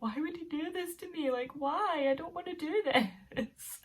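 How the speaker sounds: high-pitched imitation of fighting back tears; exaggerated, high whine as if near tears; mock tearful. Retelling own dread of the jump, mock tearful wail. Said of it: "trying to fight back the tears".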